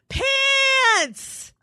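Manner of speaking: put-on high shouted toddler demand. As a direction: bark it like a bossy two-year-old. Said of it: "he's like".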